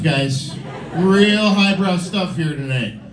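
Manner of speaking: ordinary talk, nothing marked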